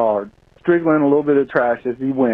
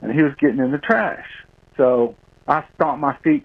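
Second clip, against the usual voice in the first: normal talking voice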